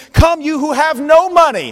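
high, loud vendor call; vendor's offer. Acted. Vendor inviting the penniless buyer, a high loud call. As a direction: hold out the offer warmly but loud. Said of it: "And he says".

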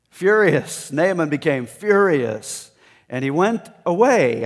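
loud, high angry outburst; furious. Acted. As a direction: let the fury burst out as Naaman. Preacher acting out Naaman's fury.

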